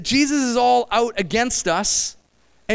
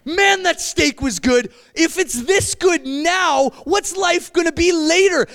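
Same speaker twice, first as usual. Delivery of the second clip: amazed delight; 8 semitones higher, slower than usual. Retold or imitated. Preacher voicing a believer's joy over a great steak. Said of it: "you realize".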